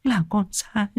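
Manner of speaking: high, soft tearful pleading; small high voice choked with remorse. Acted. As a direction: plead softly through tears, very high. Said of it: "cô Loan quỳ bên cạnh cũng mếu máo".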